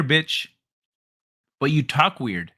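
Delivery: ordinary talk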